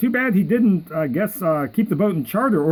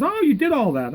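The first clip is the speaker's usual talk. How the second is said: surprised broker; 8 semitones higher than usual. Retold or imitated. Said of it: "he comes back and he says".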